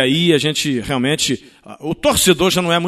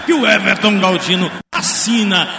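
raised, lively goal description; excited play-by-play; 4 semitones higher than usual. Real, not acted. Goal replay narration.

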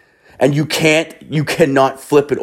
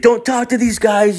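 high-pitched imitated street dealer; urgent high hustler impression; beach hustler. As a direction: warn them off in a high put-on voice. Beach dealer warning tourists off rivals.

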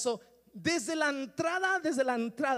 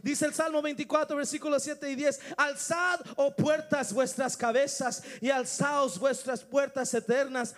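full-force preaching; 6 dB louder than usual. Acted. Preacher proclaiming Psalm 24, full force. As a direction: thunder the psalm to the church.

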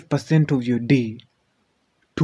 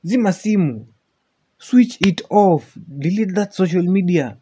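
emphatic scolding; 8 semitones higher, 5 dB louder than usual. Real, not acted. Scolding the complainers loudly.

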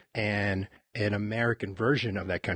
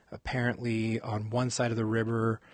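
ordinary talk

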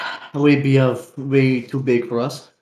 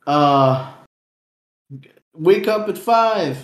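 hustle bro voice; 4 semitones higher, much slower than usual. Retold or imitated. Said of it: "they're always like".

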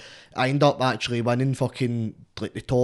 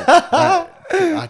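laughing; 10 dB louder than usual. Real, not acted. Bursts out laughing recalling panic.

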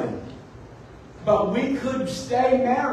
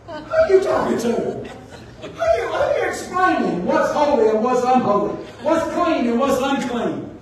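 laughing mock rebuke; 4 semitones higher than usual. Mock God rebuking Peter, laughing.

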